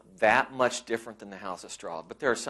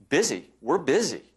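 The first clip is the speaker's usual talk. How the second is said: "Busy" and "busy" punched out higher and louder; emphasis on busy; 5 semitones higher, 5 dB louder than usual. Shown as a demonstration. Lecturer to marketers, stressing "busy" higher and louder. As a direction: lean hard on "busy" both times. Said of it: "I'm gonna emphasize the word busy".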